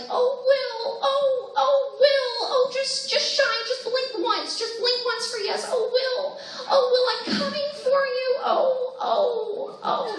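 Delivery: breathy high wailing mother calling her lost son; Winona Ryder impression. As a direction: cry out for Will in a high, trembling mother's voice. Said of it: "sitting through my impersonations".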